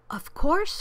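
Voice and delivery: rising, heartfelt promise; emphatic assurance. A narrator's reading. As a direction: answer instantly with conviction. Horton eagerly promises his help.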